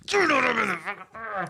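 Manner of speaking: high, loud exasperated yell; dad losing it at his toddler, high and loud; mock yell. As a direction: blow up at the toddler, half laughing.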